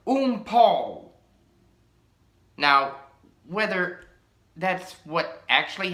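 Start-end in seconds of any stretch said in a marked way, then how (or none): 0.1-1.1 sudden gasp of awe, higher and louder. Pretend. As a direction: gasp the name as he rides into view. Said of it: "you gasp the words".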